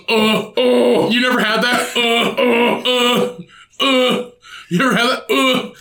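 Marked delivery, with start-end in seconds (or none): 0.1-1.1 imitating a satisfied eating grunt; hammed-up pleased "uh, uh"; mock food grunt. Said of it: "he goes". 2.0-4.3 imitating Ryan's satisfied repeated grunts; mock pleased food grunts; Ryan's eating grunt. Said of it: "that's Ryan's audible sound".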